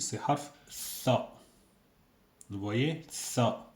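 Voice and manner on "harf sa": "sa" said as a lisped th, tongue out; th sound. Shown as a demonstration. Teacher demonstrating "sa" as a th sound to the class. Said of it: "Vous entendez ça".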